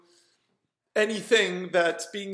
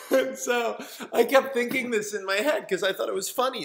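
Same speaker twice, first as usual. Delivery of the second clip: ordinary delivery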